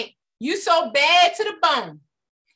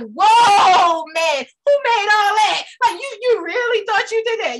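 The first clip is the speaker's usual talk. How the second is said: amazed loud man voice; a man gawking, loud and higher; man admiring woman; 5 semitones higher, 4 dB louder, slower than usual. Retold or imitated. A man eyeing a woman, amazed and loud. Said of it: "he's like".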